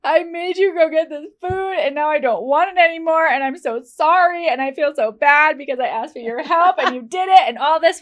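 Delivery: hammed-up tearful apology from a pregnant wife; imitating a wife crying over her craving; mock sobbing wife. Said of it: "bawling her eyes out in bed".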